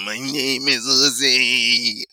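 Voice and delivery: very slow robotic monotone imitation; mock robot voice. Host mocking the wish for effects, drawn-out robot voice. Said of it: "What the fuck you want a robot voice for".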